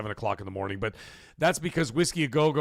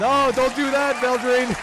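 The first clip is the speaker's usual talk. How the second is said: high, loud plea; real mock-outraged plea; loud protest; 13 semitones higher, 10 dB louder, slower than usual. Real, not acted. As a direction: shout down the viewer's idea. Protesting to chatter.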